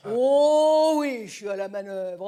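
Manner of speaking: big drawn-out groan of assent, very loud at the start; Jean Gabin. Acted. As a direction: mimic an old French film star, stretching each "oui". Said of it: "Très belle imitation de Jean Gabin". (imitation appuyée, voix traînante et forte)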